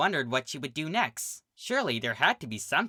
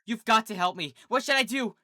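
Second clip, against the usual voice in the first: desperate filly; 8 semitones higher than usual. A narrator's reading. Sweetie begs Pinkie desperately.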